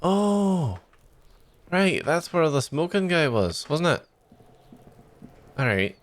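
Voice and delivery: tired, inconsistent mock Scottish accent; bad Scottish accent. Shown as a demonstration. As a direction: attempt Scottish and let it slip in and out. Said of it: "five minutes of a bad Scottish accent".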